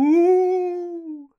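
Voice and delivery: high, loud ghostly woo; long smooth ghost wail. Shown as a demonstration. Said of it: "Look how smooth my ooh was".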